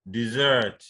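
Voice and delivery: dessert as di-ZERT, second syllable strong; stress on second syllable. Shown as a demonstration. Teacher repeating the verb, dessert stressed on ZERT. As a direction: lean on the end of dessert. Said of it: "stress that you could have".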